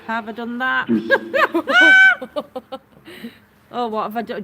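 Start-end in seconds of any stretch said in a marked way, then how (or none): 0.9-2.8 sudden high burst of laughter. Real, not acted. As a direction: crack up loudly. Laughing at screen mistake.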